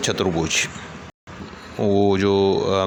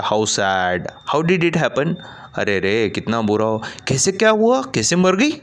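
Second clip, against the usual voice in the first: sympathetic surprise; 2 semitones higher than usual. Acted. Sadhu Charan sympathizes.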